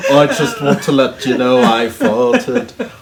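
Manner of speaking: slow, deliberate mock-British confession. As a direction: solemnly own up in a comic accent.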